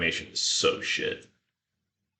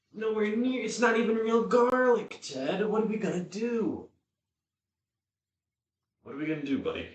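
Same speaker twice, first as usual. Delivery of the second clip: much higher whiny talk to the dog; pet voice; 12 semitones higher than usual. Real, not acted. Moaning to dog Ted about minced garlic, high whiny pet voice. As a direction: whine to your pup about garlic.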